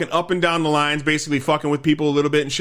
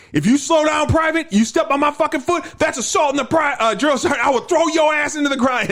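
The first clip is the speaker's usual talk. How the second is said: drill sergeant threat; 12 semitones higher than usual. Retold or imitated. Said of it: "And he'd be like".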